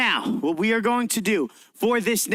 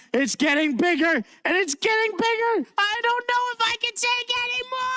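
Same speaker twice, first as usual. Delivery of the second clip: mock panic; 12 semitones higher than usual. Teacher to class as the balloon grows, a high mock-panicked squeal. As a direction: voice climbing much higher as the balloon swells.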